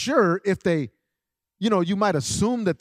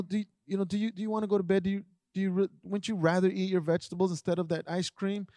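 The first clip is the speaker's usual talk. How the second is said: soft, hesitant, faster pleading questions; overly polite plea; 3 semitones higher, 5 dB quieter than usual. Retold or imitated. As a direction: beg the child sweetly, tripping over the words. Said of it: "we want to be so polite".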